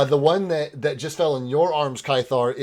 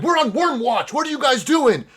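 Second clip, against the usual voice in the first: guard voice; 13 semitones higher, 6 dB louder than usual. Acted.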